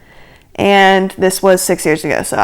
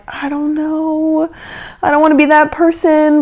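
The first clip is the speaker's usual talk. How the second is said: shy reluctance; 5 semitones higher, 4 dB quieter than usual. Retold or imitated. Shy girl in the back balks, hesitantly. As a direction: begin soft and stretched, reluctant to object. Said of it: "kinda shy, and there was one girl".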